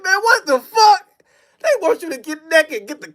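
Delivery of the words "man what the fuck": high, slow laughing-crying protest; stunned, whiny disbelief at the order to strip. Retold or imitated. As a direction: cry it out, half laughing in shock.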